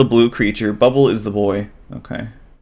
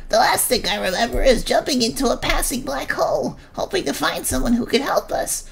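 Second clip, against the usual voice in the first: high, softer, timid little creature voice; nervous squeaky creature; 4 dB quieter than usual. Acted. Blue creature introduces itself to Bubble, high and timid.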